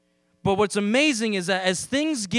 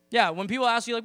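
ordinary talk

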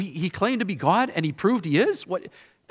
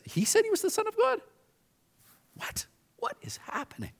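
confused disbelief; 3 semitones higher, 6 dB quieter than usual. Retold or imitated. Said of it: "imagine Simon standing there going".

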